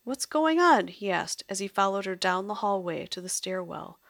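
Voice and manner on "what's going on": loud, high alarmed call; alarmed question. Acted. The maestro calls after Lily in alarm. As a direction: ask in sudden alarm. Confused while being dragged away.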